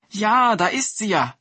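overjoyed woodcutter calling out thanks; joyful cry. A narrator's reading. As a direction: burst out with happy relief. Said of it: "rief Chadol und freute sich".

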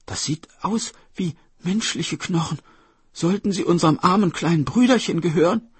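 high voice rising in dismay; horrified child. Acted. Children find their brother's bones, high horrified voice. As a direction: realize the horror as you speak.

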